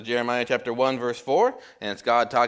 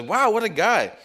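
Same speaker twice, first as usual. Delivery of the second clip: impressed passenger; 10 semitones higher than usual. Retold or imitated.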